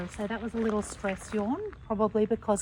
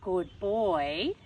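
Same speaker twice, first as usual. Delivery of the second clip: warning tone; 4 dB louder than usual. Shown as a demonstration. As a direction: warn the dog while sounding friendly. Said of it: "the tone that I would use for Levi".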